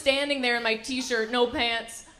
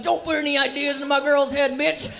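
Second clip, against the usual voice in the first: put-on protective boyfriend; 3 semitones higher, 5 dB louder than usual. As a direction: voice the jealous guy warning her off.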